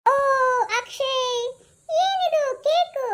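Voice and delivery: aunt stunned by a surprise cake, slow high wonder; surprised. Acted. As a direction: draw it out in delight at the cake.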